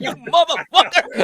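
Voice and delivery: high, slow laughing curse; laughing mock curse. Cursing his friend in the retelling, laughing slowly. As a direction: drag it out through laughter. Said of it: "I'm like".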